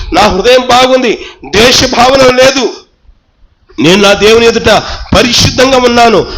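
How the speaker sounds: steady, declaring sermon voice; bold preaching. Acted. Pastor voicing a self-assured believer, bold preaching. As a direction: proclaim each claim boldly.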